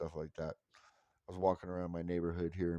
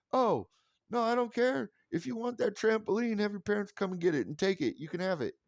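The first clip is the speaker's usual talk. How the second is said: high, louder mock-cheerful offer; overdone eagerness; 12 semitones higher, 3 dB louder than usual. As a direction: hand it over gladly, hamming it up. Exaggerated reply to kid.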